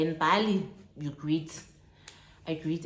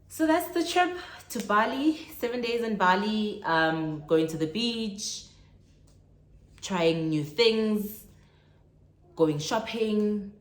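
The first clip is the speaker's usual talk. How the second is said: excited recap; 5 semitones higher, 4 dB louder than usual. Real, not acted. Excited trip summary.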